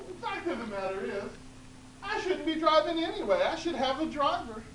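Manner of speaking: put-on high, loud laughing old woman; loud giggly old woman.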